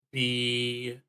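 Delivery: normal talking voice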